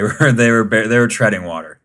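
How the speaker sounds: ordinary talk, nothing marked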